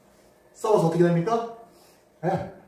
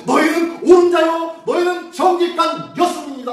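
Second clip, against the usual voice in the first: proclaiming; 9 semitones higher, 6 dB louder than usual. Retold or imitated. Preacher voicing God's declaration to sinners, loud and proclaiming. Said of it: "라고 선포해버리네요".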